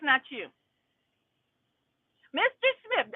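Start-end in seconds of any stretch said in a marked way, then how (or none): 2.3-3.1 high mocking call of the name; sarcastic judge. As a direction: lift the name high, talking down. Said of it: "Sarcastic people".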